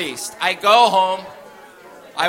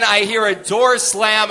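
usual speaking voice

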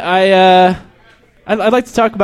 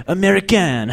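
mock European accent; 2 semitones higher than usual. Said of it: "They're like".

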